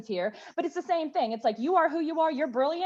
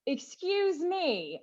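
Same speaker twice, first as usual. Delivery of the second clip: indignant; 5 semitones higher, 3 dB quieter, much slower than usual. Real, not acted. Indignant reaction to husband question.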